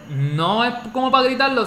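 ordinary talk

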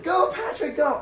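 high, excited cheer; mock cheer. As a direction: cheer him on like a fan at the roadside. Cheering sign read as a shout.

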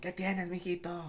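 high, slow mom voice; worried mom voice. Retold or imitated. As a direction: play the fretting mother. Voicing a mother scolding.

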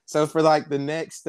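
ordinary talk, nothing marked

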